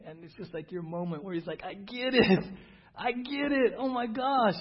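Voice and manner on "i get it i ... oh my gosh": high, loud burst of sudden understanding; excited realization. Retold or imitated. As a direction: blurt it out like it finally clicked. Realization moment voiced excitedly.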